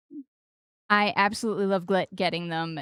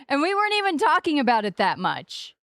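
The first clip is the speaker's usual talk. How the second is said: usual speaking voice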